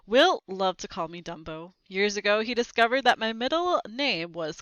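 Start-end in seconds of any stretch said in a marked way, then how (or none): none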